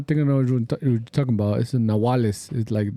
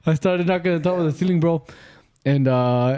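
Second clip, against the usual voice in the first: usual speaking voice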